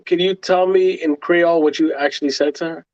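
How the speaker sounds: usual speaking voice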